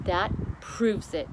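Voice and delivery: slow, weighty verdict; queen convinced, slow and firm; grave queen. Acted. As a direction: let each word land slowly.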